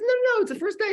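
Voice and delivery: very high, loud overruling protest; hammed-up emphatic correction; acted insistence. Rabbi acted, insisting over objection.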